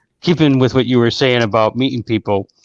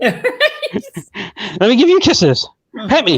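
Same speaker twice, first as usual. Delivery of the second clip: higher, demanding dog voice; the dog asking for affection, voiced higher; voicing the dog; 9 semitones higher than usual. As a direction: beg for pets like a needy dog. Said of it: "it was like".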